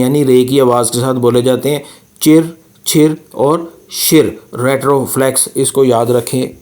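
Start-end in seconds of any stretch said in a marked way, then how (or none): 2.2-4.4 चिर, छिर और शिर slow, r curled back; retroflex chir chhir shir. Shown as a demonstration. Teacher demonstrating Pinyin retroflex syllables slowly. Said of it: "तीन words रेट्रोफ्लेक्स होते हैं".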